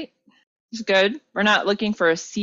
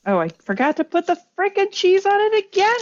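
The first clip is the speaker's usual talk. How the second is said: exasperated; 13 semitones higher, 4 dB louder than usual. Real, not acted. Exasperated at herself.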